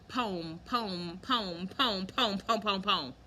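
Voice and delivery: "poem" chanting की तरह बार-बार; repeating poem. Shown as a demonstration. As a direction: say "poem" over and over, rhythmic. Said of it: "It kinda sounds like a weird word if you say it a lot".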